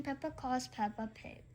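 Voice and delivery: usual speaking voice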